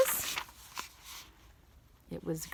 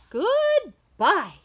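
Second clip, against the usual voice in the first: much higher, a little louder farewell; high character goodbye; 11 semitones higher, 4 dB louder than usual. Acted. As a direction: sing the goodbye up high in another voice. Repeated goodbye exchange.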